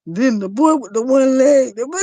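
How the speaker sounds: high, loud read-out, collapsing into laughter; reading like the commenter. Retold or imitated. Host rereading the comment as its writer would say it, cracking up. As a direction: quote it loudly until the laugh takes over. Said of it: "I'm reading it like how you would say it".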